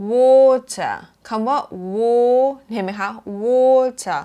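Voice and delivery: WATER with a drawn-out, loud aw; long rounded o. Shown as a demonstration. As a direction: stretch the first vowel of WATER, lips round. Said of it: "แล้วก็กลมนะ แล้วก็ยาวนะคะ". (water ลากเสียงออยาว ปากกลม)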